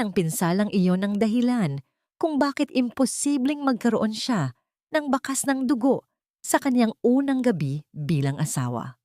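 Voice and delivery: plain reading voice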